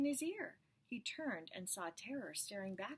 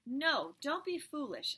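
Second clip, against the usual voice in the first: hammed-up hiss; 7 semitones higher, 8 dB louder than usual. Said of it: "a voice whispered".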